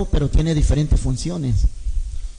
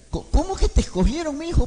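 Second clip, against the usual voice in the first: incredulous mother; 10 semitones higher than usual. Retold or imitated. Madre incrédula.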